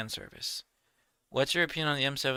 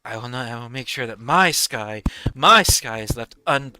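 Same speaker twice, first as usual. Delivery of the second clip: villain voice; 9 dB louder than usual. Retold or imitated. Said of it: "I voiced it".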